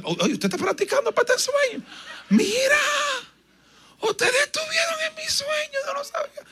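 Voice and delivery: high, shouting then breaking into a cry; a dreamer crying out in panic at the church; panicked cry. Retold or imitated. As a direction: plead in a frightened high voice.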